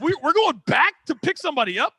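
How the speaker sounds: high, rising disbelief; can't believe what he's hearing; incredulous. Retold or imitated. In the cop car, hearing they'll turn back, high incredulity.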